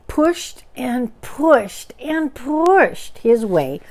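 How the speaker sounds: very slow, drawn out pushing; straining. A narrator's reading. Narrator acting Pooh squeezing through hole, slow strain. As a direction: stretch each push like effort.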